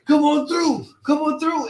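high, cheery come-on-through; old man voice. Retold or imitated. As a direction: wave them on in a high voice.

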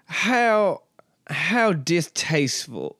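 slow, genteel Southern drawl; aristocratic Southern. Acted. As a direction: play a Southern aristocrat, drawing it out. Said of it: "aristocracy Southern that I'm doing".

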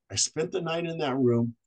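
ordinary delivery

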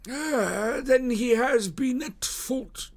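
satisfied exclamation in a high, slow character voice; pleased detective, pitched very high; evident satisfaction. A narrator's reading. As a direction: savour a rival's slip, high and drawn out. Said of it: "exclaimed Jones with evident satisfaction".

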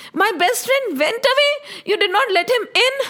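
high, rising, incredulous questioning; dismayed, accusing questions; shocked disbelief. Acted. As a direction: repeat it aghast, then accuse. Krishna appalled at the guards.

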